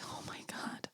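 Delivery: breathless whisper of amazement; hushed fan gasp; awed whisper. Retold or imitated. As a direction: whisper it like you can't believe it. Imagined listener gasping in awe.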